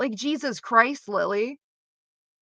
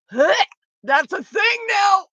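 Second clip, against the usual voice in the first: much higher, slow, shouted disbelief; surprised shout; 8 semitones higher, 5 dB louder than usual. Retold or imitated. Reacting in a chat to a new meme, a shouted surprised squeal. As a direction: blurt it out, stunned.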